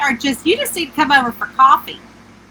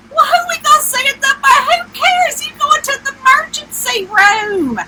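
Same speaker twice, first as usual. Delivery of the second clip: high-pitched, rushed, exasperated comeback; retold outburst, voice way up in disbelief; exasperated protest; 11 semitones higher than usual. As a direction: argue with mom, incredulous and squealing high. Retold argument with mom.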